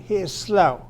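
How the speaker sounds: normal talking voice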